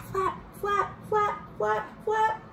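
flap flap. Acted. Making the toy bird fly.

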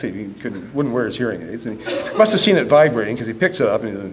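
ordinary talk, nothing marked

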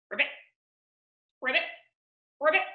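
short pretend frog croaks; quick little ribbits; frog ribbiting. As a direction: imitate a frog, leaving gaps between calls. Imitates a frog for guessing.

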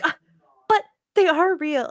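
mock flustered sister; high, loud imitation of a confused sister protesting; imitando no. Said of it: "She gets so confused".

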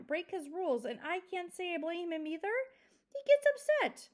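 put-on father getting carried away warning the children; rising agitation. A narrator's reading. Father grows worked up.

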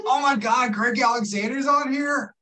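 high-pitched, excited cry of recognition; excited surprise. Real, not acted. Host spots Greg Alexander on the live, excited surprise. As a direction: exclaim it high, thrilled to spot someone. Thrilled at spotting a guest joining.

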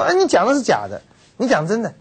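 demanding king. Retold or imitated. Ahab scolding Micaiah for lying, high and loud. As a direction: catch the lie and demand truth.

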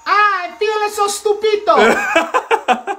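high, slow Italian plumber voice; Mario voice. Shown as a demonstration. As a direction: stretch it out like Mario. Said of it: "how does he say it?".

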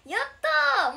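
joyful shout. Real, not acted. Fan says he supports only her.

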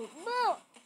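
sudden high shout at full voice; a startled gamer's high-pitched yell; loudest shout. Real, not acted. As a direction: let out the biggest yell you've got. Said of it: "αυτό ήταν η πιο δυνατή μου φωνή".

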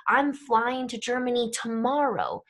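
"tomorrow" stressed with the voice. Shown as a demonstration. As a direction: lean on the word "tomorrow" at the end. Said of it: "We do it with our intonation".

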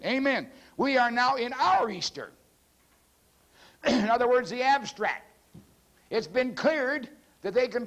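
high-pitched, driving preacher delivery; sermon proclamation pitched well up; impassioned preaching. Real, not acted. Preaches in raised voice.

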